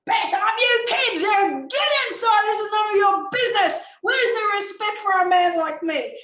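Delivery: high, loud enraged father's shout; furious acted bellowing; angry yelling. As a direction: yell at the kids in full fury. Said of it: "And Daddy yells at them".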